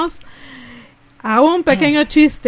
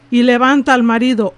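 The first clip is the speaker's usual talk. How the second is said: ordinary talk, nothing marked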